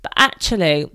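ordinary talk, nothing marked

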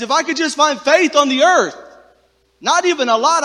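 preacher crying out God's words; impassioned plea. Retold or imitated. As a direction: cry out for a little faith.

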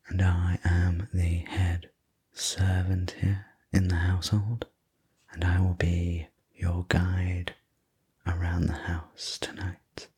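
ordinary delivery, nothing marked

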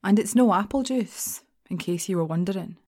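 plain reading voice

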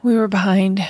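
usual speaking voice

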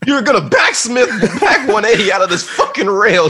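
loud high barked orders, laughing; drill sergeant. Pretend. Skate parent barking orders.

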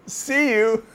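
high, bright farewell; cheerful bird. A narrator's reading. Bird cheerfully says goodbye and flies off. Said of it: "And the bird says".

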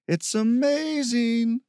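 high, drawn-out sing-song. A narrator's reading. Steve delighted by the smell at the brook, sing-song. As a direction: stretch it out loud and high in a lilting singsong. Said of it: "in a sing-song voice".

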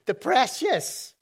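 a hint of Gollum hissing precious; half-hearted Gollum impression; Smeagol. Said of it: "that Smeagol attitude".

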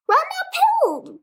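high bleating call; sheep crying out. A narrator's reading. Sheep urging Wilbur, high crying call. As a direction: cry it like a sheep. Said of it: "cried the sheep".

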